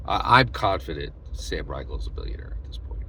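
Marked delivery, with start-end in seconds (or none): 1.3-3.0 a sly, hushed aside; whispered aside. Real, not acted. Vlogger confiding a jab to his viewers in a whisper. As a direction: let the audience in on a secret.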